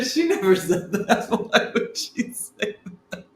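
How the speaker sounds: talking through real laughter; laughing. Real, not acted. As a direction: squeeze the words out while cracking up. Cracks up at own joke.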